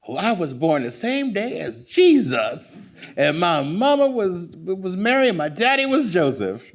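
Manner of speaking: boastful great aunt. Retold or imitated. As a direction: brag it like the old aunt showing off. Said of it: "never let you forget".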